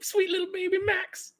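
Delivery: put-on high cooing baby talk. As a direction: coo like you're talking to a baby.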